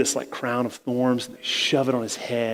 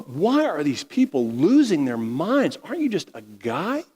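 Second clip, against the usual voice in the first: exasperated Pilate; 4 semitones higher than usual. Acted. Pilate asks Jesus why the crowd rages, exasperated higher voice. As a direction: play a baffled governor losing patience.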